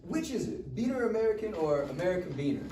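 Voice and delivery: mocking jeer mid-fight; shrill taunt. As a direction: goad him while wrestling. Max taunting during wrestling.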